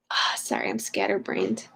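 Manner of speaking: breathy whispered apology to herself; whispered "I'm scatterbrained"; whispered aside. Real, not acted. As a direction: drop to a whisper, embarrassed.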